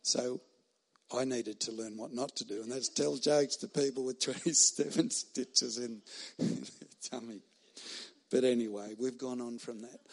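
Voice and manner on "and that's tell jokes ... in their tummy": laughing. Real, not acted. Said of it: "laughs while telling".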